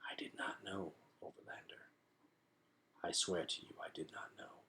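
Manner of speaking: hushed unvoiced whisper. A narrator's reading. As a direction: whisper it close, ashamed. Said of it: "He heard the bat whisper".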